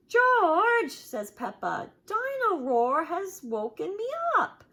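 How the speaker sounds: high, loud whining complaint; cross Peppa. Acted. Peppa complains to George she was woken, high and cross. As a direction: scold your brother.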